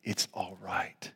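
slow, low, breath-soft whisper; whispered reassurance. Acted. Jesus to all in a storm, whispered comfort. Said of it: "he's saying those words to all".

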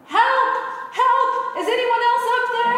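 very high, loud frantic yell; man rejects God's advice and yells for other help. Acted. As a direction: scream for anyone else up there. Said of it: "Jack yelled".

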